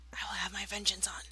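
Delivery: whispered villain threat; mock vengeful whisper. Vlogger vowing revenge on prankers, mock whisper. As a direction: hiss the vengeance vow.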